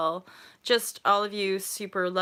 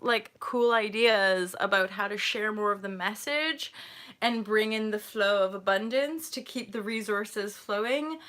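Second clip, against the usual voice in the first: ordinary talk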